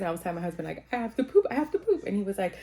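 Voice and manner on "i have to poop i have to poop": high, nervous retold plea; urgent pitched-up repeat, mock panic; nervous urgency. Telling her husband she needs to poop, nervous. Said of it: "I was so nervous".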